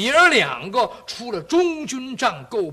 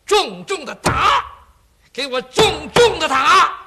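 loud, slow, higher command to beat harder; shouting order; 7 semitones higher, 10 dB louder than usual. Acted. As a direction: bark the order at the executioners. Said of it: "一劲儿喊". (元帅高声喊着重重地打)

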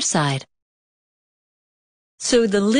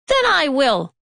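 higher, resolute hen; determined hen; 6 semitones higher than usual. A narrator's reading. Hen deciding to do the work alone, resolute. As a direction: say it like the hen settling it herself. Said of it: "said the little red hen".

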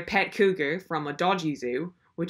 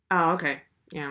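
usual speaking voice

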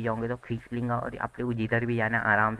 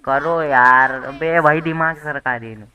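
loud, high, fed-up outburst; exasperated shout; 3 semitones higher, 12 dB louder, slower than usual. Real, not acted. Gamer fed up with characters bothering him, exasperated shout. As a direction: burst out loudly, stretching the words in annoyance.

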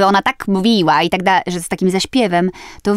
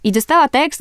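normal talking voice

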